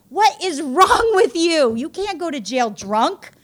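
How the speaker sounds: loud, slow, high exasperated yell; exasperated yell at husband. Retold or imitated. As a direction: shout it slowly at a drunk husband. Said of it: "I was like".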